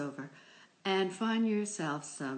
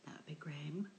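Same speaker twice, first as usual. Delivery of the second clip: whisper; 8 dB quieter than usual. Acted. Opens with a spooky whisper.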